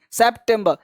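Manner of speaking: ordinary talk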